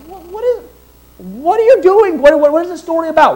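hammed-up bewilderment; mock baffled question. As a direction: imitate confused listeners. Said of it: "people asking what it's about".

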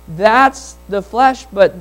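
normal talking voice

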